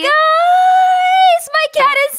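crying. Acted. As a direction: sob it slowly like a heartbroken cartoon. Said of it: "both of you stop crying".

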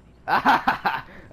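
loud burst of laughter; big laugh. Real, not acted. Friend's joke.

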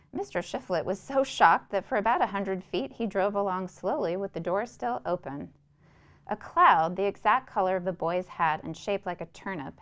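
measured, even storytelling read; story narration. A narrator's reading. Narrator reading a Flannery O'Connor story to listeners, measured storytelling. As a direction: read the tale aloud, steady and unhurried. Reads the short story aloud.

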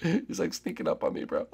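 soft hushed whisper. Acted. Hiding player whispers that the hunter is sneaking up. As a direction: hush it so he won't hear.

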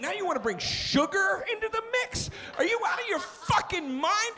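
high-pitched indignant rant; mock outrage. Customer ranting at the soda offer, mock outrage. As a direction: build to an incredulous, high outburst.

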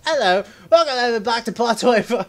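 high, loud mock British accent. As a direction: greet like a hammed-up British person. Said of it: "I sounded like a British person".

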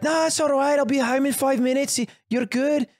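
high, friendly reassurance; reassuring woman. Retold or imitated. Woman reassuring Paddy about the lawn, high friendly voice. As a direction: voice the woman brushing it off kindly.

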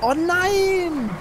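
dismayed cry. Real, not acted. Car gets knocked back in race.

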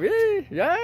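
high yeah yeah; squeaky praising a dog. Real, not acted. Praising a pet.

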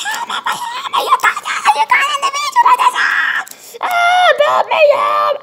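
very high, loud shrieking; hammed-up screams for help. Character shrieking to the guys in the video. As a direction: shriek it in mock panic.

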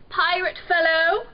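high, loud royal voice; princely address. A narrator's reading. Prince opening a truce with the pirate, formal. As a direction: hail the rival, lifting at the end.

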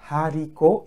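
Haricot begun with a breathed h; aspirated h. Shown as a demonstration. Teacher showing aspirated letter, Haricot with audible h. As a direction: sound the h in Haricot, "ha-ree-ko".

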